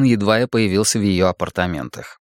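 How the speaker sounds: usual speaking voice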